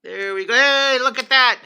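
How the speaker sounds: a sudden delighted squeal; excited. Real, not acted. Host thrilled to see viewers arriving, excited squeal. Viewers joining the live stream.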